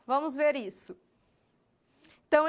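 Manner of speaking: ordinary talk, nothing marked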